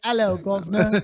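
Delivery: hammy cockney greeting; imitating a Brit; mock British accent. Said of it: "you were like".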